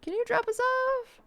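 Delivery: timid plea to get out now; nervous plea. Retold or imitated. Frightened request to driver.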